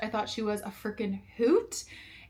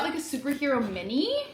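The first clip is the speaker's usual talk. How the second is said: ordinary delivery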